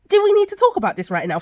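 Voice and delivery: scolding the characters in disbelief; exasperated outburst. Acted. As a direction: demand it loudly, pitch jumping up. Rant at characters ignoring the danger.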